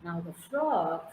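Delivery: ordinary talk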